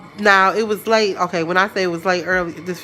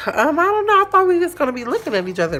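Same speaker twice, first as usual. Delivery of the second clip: high, flustered and caught off guard; mock flustered squeak; 9 semitones higher, faster than usual. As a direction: squeak it out, embarrassed. Flustered reply to date.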